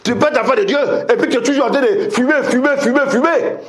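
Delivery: high-pitched, driving exhortation; full-force preaching. Real, not acted. Preacher urging believers to quit smoking, full-force preaching. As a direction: keep pushing at the top of the range.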